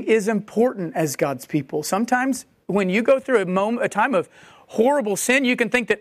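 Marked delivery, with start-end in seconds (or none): none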